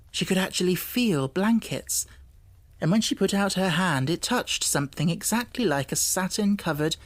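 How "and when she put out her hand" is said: ordinary delivery, nothing marked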